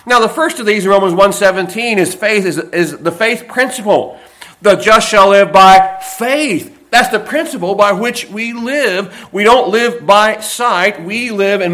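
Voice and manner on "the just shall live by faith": loud, slow, every word weighed; proclaimed verse. Acted. Preacher proclaims the key verse, loud and slow. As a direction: proclaim it slowly.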